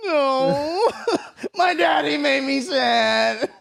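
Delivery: high fake sobbing, childish; mock crying. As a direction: whine it like a kid faking tears. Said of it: "So that's all I did".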